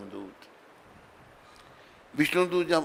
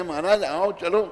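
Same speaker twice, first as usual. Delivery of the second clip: urgent call; 6 semitones higher, 6 dB louder than usual. Acted. Messengers calling the king.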